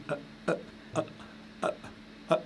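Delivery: A chopped off short by a closed throat; isolated, abrupt A, each stopped before it can ring out; glottal stop demo. Shown as a demonstration. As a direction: start each A and cut it dead at the glottis. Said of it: "c'est un coup de glotte".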